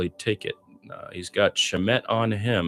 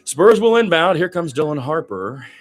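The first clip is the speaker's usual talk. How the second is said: excited call; 7 semitones higher, 10 dB louder than usual. Real, not acted. Play resumes after rant.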